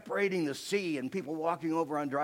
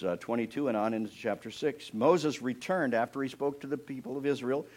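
ordinary talk, nothing marked

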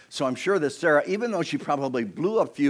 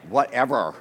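loud, sassy "whatever"; with attitude; 3 semitones lower, 6 dB louder than usual. Shown as a demonstration. Preacher showing congregation how, sassy attitude. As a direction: punch it out with attitude. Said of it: "Say it with attitude".

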